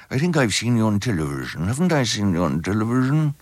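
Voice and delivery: swooping royal impression, rising at the end; Prince Charles. Prince greeting the actor in a receiving line, mimicked royal voice. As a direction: mimic a puzzled royal making small talk. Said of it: "Prince Charles said".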